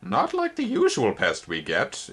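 slow, thin insect voice, lightly curious. A narrator's reading. Spindlebug studying Twig, slow curious insect voice. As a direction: observe it coolly. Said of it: "it observed".

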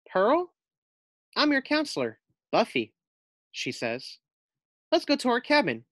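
high, upbeat camp counselor; a lightly voiced perky collie; cheery counselor. Collie counselor greeting new campers, perky.

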